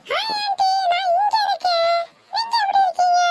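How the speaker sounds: put-on chirpy trendy girl greeting her aunt; modern bride-to-be.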